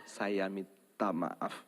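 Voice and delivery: very slow, low and soft; slow soft apology. Shown as a demonstration. Congregation saying sorry to neighbours, slow and soft. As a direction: say it humbly, drawn out.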